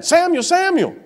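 high, ringing call of the name; calling voice of God. Retold or imitated. God calls the sleeping boy Samuel, high calling voice. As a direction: call out across the dark, lightly voiced. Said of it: "God calls him again".

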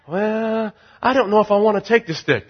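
high, hesitant mock reply; reluctant doubter. Reluctant listener answering the preacher's challenge, mock hesitant.

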